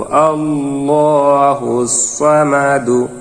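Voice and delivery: very slow drawn recitation; joined reading. Shown as a demonstration. Teacher reads the verse joined, very slow. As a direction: stretch every syllable. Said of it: "এবার আমরা মিলিয়ে পড়ব".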